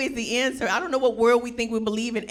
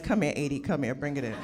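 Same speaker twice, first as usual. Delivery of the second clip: soothing hug voice; 7 semitones lower than usual. Pretend. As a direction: coax him into a hug.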